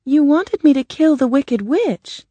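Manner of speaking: put-on high girl's voice, brave; plucky, high-pitched girl; Dorothy. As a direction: stand up to the wizard in a high, girlish voice. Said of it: "Dorothy said bravely".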